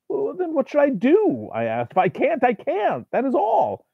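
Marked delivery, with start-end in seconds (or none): 0.1-1.5 high, strained plea; anguished question. A narrator's reading. Narrator pleading with the demon, high strained voice. 1.9-3.9 high, strained insistence; desperate refusal. A narrator's reading. Narrator refusing the demon, high pleading voice.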